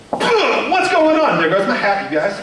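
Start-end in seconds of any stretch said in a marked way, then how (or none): 0.1-1.4 higher, hammed-up would-be rescuer; heroic rescuer act. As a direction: burst in high, playing the rescuer. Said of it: "have that in me to be like".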